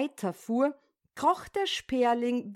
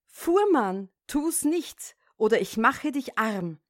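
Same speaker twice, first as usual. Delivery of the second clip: usual speaking voice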